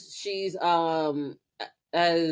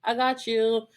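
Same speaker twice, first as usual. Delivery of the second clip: mock villain gloat; 6 semitones higher, slower than usual. Kirkin gloating over caught witch.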